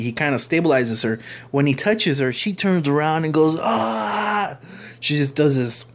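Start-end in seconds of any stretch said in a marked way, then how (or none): 3.6-4.6 sudden high mock scream from an old lady; imitating a startled shriek, pitched way up; mock super yell. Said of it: "like, super yell".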